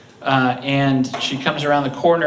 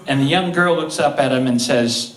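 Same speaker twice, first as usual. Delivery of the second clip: usual speaking voice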